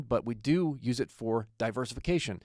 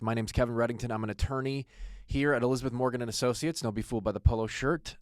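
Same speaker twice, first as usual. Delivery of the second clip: ordinary talk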